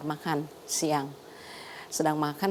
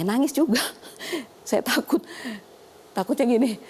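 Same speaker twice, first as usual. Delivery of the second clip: high-pitched, amused confession; laughing; 7 semitones higher, 3 dB louder than usual. Real, not acted. Doctor recalling her own crying and fear, laughing. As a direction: laugh while admitting the fear.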